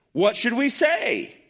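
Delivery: high, drawn-out sarcastic question; mock sarcastic. Preacher voicing Paul's obvious question, high slow sarcasm. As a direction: stretch it out high with put-on wonder. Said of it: "sounds like he's kind of being sarcastic".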